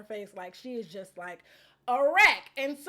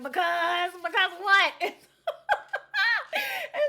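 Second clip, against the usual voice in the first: laughing; 7 semitones higher, 5 dB louder, much slower than usual. Retold or imitated. Mock-pushing Tiffany to finish, laughing.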